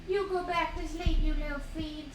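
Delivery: high cooing scold; a sweet high scold. Real, not acted. Mother sending her dog back to sleep in high baby talk. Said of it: "I'm in baby talk mode".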